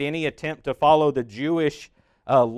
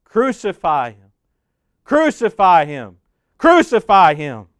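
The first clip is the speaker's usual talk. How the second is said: chanted cry, growing louder with each repeat; crowd crying out; 2 semitones higher, 8 dB louder than usual. A narrator's reading. Narrator voicing the crowd answering Pilate, rising chant. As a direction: call it out as the angry crowd. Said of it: "the crowd cries out".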